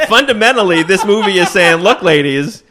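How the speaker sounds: high, loud, laughing sermon; laughing mock lecture. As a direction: preach it while cracking up.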